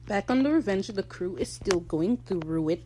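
usual speaking voice

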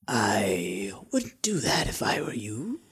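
slow, breathy little whisper; twinkling breathy fairy whisper. Acted. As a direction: breathe it out softly, like a hidden sprite. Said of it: "you hear a little voice".

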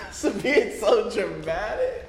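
high, drawn-out mock complaint through laughter; mocking mimicry. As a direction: parrot her complaint in a slow high voice, laughing. Listener mimics the retold girlfriend.

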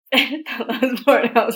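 laughing loudly through it; laugh-cry the words out. Real, not acted. Laughing at own flub.

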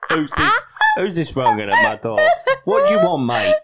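high, loud comic householder, half-laughing; hammed-up challenge to whoever's at the door; irate householder. Householder demands what the caller wants, in irate voice.

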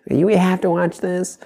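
high, drawn-out wifely complaint; complaining wife impression. Husband imitates wife who hates basketball on TV, high drawn-out complaint. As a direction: imitate your wife objecting, slow and high. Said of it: "she sounds exactly like that".

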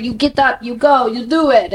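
imitating French agents' accent. Model mimicking hardcore French agents, French accent. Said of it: "if that was a good French accent".

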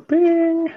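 high, sustained "bing"; imitated chime. Shown as a demonstration. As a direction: sing out a little notification ding. Imitates notification while demonstrating follow.

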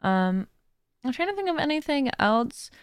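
usual speaking voice

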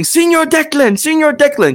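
high, hammed-up French accent; mock French accent. Game master voicing a French NPC, hammed-up French accent. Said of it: "So in this French accent".

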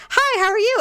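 high, over-bright mock hello; awkward fake-casual greeting. Patient greeting her while staring at bandage, awkwardly high.

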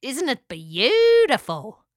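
slow, drawn-out pelican voice; dreamy, slow delight; character voice. A narrator's reading.

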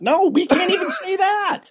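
high-pitched, laughing objection; laughing protest. Real, not acted. Joking with co-hosts about having none of it, laughing protest.

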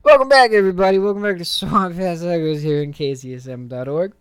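loud, high show-opening announcement; radio host welcome. Acted. Host welcoming listeners back after the break, big announcer energy.

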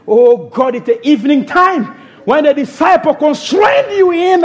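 normal talking voice

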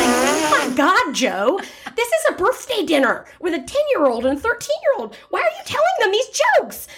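high, scolding disbelief rising to a peak; exasperated mom. Retold or imitated. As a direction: rein in the husband's crude jokes. Mom scolding husband at dinner.

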